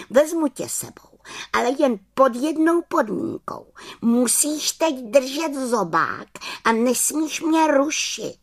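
high, bossy little witch; high, firm story-character voice. A narrator's reading. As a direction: lay down terms as a small witch.

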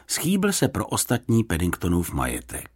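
usual speaking voice

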